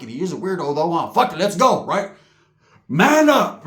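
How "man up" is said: high, loud self-push; a loud, high man up; pumped-up shout. Retold or imitated. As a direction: psych yourself up. The teller urging himself on.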